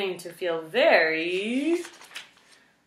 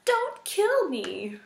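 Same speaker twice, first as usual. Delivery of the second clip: high, slow, pleading squeak; mouse crying in terror; 12 semitones higher, much slower than usual. A narrator's reading. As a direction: beg for your life, teeth chattering. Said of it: "Poppy cried through chattering teeth".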